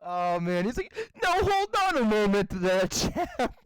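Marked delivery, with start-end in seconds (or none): 1.2-3.5 higher, mock-British protest; imitated British guy. Retelling the British guy meme, mock-British objection. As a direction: put on a puzzled British voice. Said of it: "it's a British guy".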